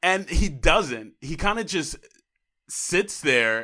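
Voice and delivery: exasperated. Real, not acted. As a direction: hammer home the frustration. Heated debate over movie ending.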